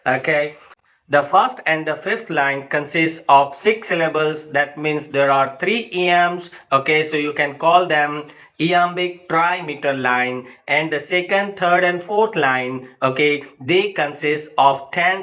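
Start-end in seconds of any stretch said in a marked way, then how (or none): none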